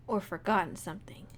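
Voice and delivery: low, soft musing; pondering. Acted. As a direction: think it over under the breath. Reads character puzzling it out.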